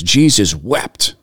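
"Jesus wept" said slowly with weight on both words; solemn quote, drawn out; slow, weighty emphasis. Shown as a demonstration. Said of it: "The emphasis on that".

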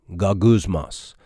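"Gogusmos" repeated slowly, GAH-gooz-mahs, falling at the end; Greek word again. Shown as a demonstration.

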